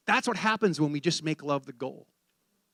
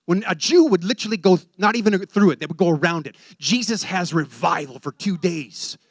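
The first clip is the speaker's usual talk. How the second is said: rising, louder sermon voice; fervent preaching; 5 semitones higher, 8 dB louder than usual. Acted. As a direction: preach it out to the congregation. Preaching at full force.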